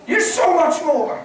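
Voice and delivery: very high, loud, slow shout; hammed-up heavenly rebuke; mock incredulous shout. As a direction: shout it like a loved one in heaven. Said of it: "they're gonna say".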